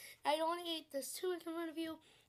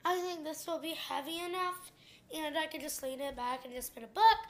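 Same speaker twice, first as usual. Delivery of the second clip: normal talking voice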